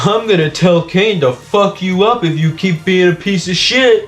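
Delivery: mock manic threat, pitch climbing; lightly acted unhinged outburst; Gangle. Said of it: "having a manic breakdown and goes".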